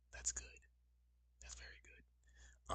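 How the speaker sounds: very soft whispered approval; relieved whisper. Real, not acted. Streamer relieved that the sound works, whispering to himself.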